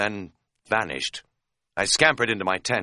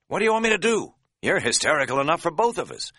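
higher, louder irritated comeback; fed-up, raised voice; exasperated; 10 semitones higher, 3 dB louder, faster than usual. A narrator's reading. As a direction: snap back at a panicking friend. Exasperated friend in an argument.

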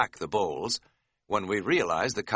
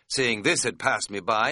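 ordinary delivery

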